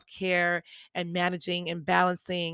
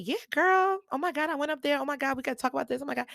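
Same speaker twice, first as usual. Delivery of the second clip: much higher, fast excited chatter; giddy gushing; 7 semitones higher, faster than usual. Retold or imitated. Gushing to her friend after speaking on stage, giddy and fast. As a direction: spill it all out breathless with glee.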